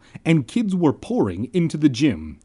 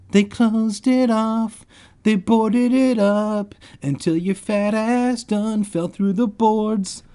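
slow, higher sassy voice; slow mocking drawl; 10 semitones higher, slower than usual. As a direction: stretch it out with sass. Reader rewrites line in slang.

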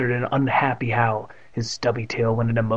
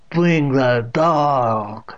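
loud, very drawn-out order; insist, stretching each word; insistent old aunt; 5 dB louder, much slower than usual. A narrator's reading. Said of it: "Catherine insisted".

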